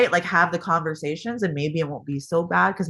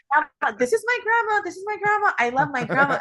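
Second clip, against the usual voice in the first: put-on high, sing-song little girl, much higher; child's voice; 14 semitones higher than usual. Mother imitates daughter hugging grandma in a high child's voice. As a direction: gush like a small child showing off her grandma. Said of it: "like, holding her".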